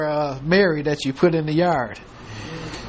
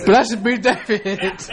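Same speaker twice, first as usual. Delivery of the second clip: slow, higher, laughing through the blessing; laughing mock prophecy; 4 semitones higher, slower than usual. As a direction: let Saul's blessing dissolve into laughter. Saul himself prophesies, laughing.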